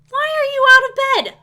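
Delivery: high, loud scolding yell; fed-up parent shout; exasperated shout. Real, not acted. Scolding Sim child at screen.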